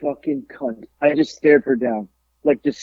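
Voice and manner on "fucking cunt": seething insult kept under the breath; muttered insult under breath. Retold or imitated. To the panelist who rejected him, slow soft seething insult. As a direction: say it slow and very soft, barely audible. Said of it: "And I'm like".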